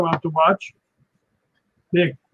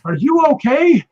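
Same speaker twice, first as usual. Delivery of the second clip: concerned director; 9 semitones higher, 4 dB louder than usual. Retold or imitated. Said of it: "Richard comes over and he goes".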